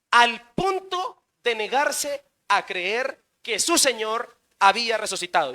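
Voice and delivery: ordinary talk, nothing marked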